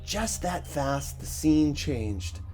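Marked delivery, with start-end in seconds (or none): none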